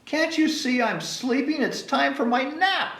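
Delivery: high indignant complaint; cranky baby bird; grumpy baby bird. Acted.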